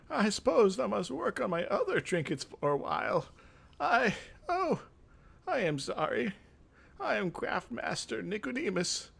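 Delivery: put-on high, downcast old man; old mage sighing over his dead pets, high and sad. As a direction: mourn the lost work, then catch yourself. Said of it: "The old man looks sad".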